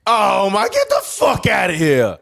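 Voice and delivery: high, slow, drawn-out shout; outraged disbelief. Real, not acted. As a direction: yell it at the ref in disbelief. Outraged at referee call.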